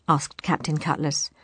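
ordinary delivery, nothing marked

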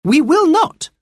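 put-on high girl's voice, firm refusal; a girl standing up to the chief; defiant girl. A narrator's reading.

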